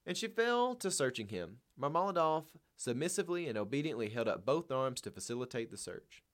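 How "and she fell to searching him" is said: ordinary delivery, nothing marked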